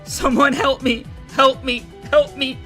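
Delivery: high loud crying for help; girl pleading while being attacked; desperate plea. Acted. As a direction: call out in terror.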